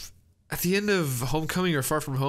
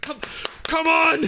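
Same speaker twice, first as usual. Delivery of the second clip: exasperated shout; 13 semitones higher than usual. Retold or imitated. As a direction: yell it at the screen. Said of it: "and it's like".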